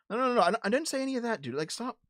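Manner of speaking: high, fast, flustered denial; can't believe they twisted his words; exasperated protest. Real, not acted. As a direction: cut off the misreading fast and high. Protests being misquoted by chat.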